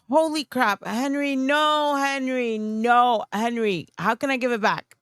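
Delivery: high, drawn-out protest of gratitude; overwhelmed, touched. Real, not acted. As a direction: plead with a generous friend, voice pitched high. Overwhelmed by a friend's gift.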